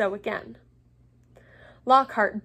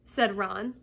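usual speaking voice

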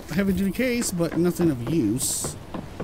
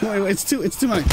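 ordinary talk, nothing marked

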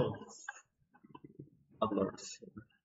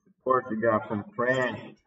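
usual speaking voice

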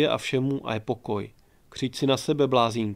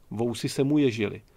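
usual speaking voice

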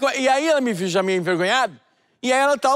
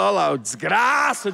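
low, slow mock grumble under the breath; a slow, low jealous grumble; 4 semitones lower, slower than usual. Father-in-law grabs the steak. (resmungo baixo e lento, de brincadeira)

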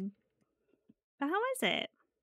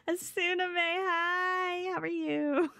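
put-on high, sweet e-girl greeting; cute e-girl voice; 7 semitones higher, slower than usual. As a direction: coo it like an e-girl. Cute voice practice.

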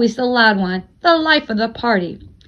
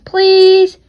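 high, louder begging please; 8 semitones higher, 8 dB louder than usual. Acted. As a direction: plead like a kid wanting sneakers. Charlie begging for sneakers.

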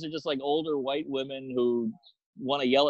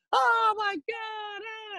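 a hammed-up shriek of horror; horrified wail; much slower than usual. Outed guest wailing in horror at the comedian.

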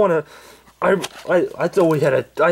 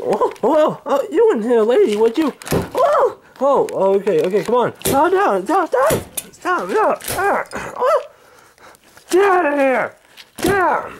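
high yelping cranky grandpa; high panicked old man; 13 semitones higher, slower than usual. Acted. Grandpa being hit by the fake lady, high panicked yelps. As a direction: squeal for mercy, then shout them out.

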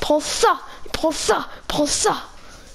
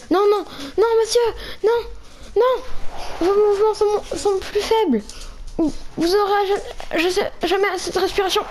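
higher, urgent pleading; alarmed; 6 semitones higher than usual. Acted. Player begging his companion, higher with alarm. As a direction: plead with him in rising alarm.

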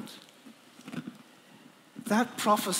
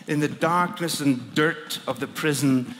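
ordinary delivery

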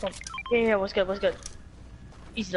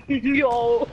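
laughing excited; 5 semitones higher, slower than usual. Real, not acted. Laughing gamer hyped by play.

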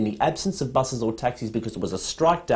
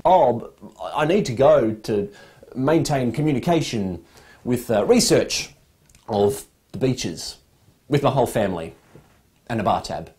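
mock politician; 5 semitones lower than usual. Mocking politicians justifying trips.

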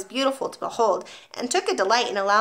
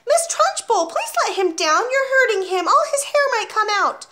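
much higher, louder desperate plea; crying out; 13 semitones higher, 5 dB louder than usual. A narrator's reading. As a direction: beg her to stop, alarmed. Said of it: "Miss Honey cried out".

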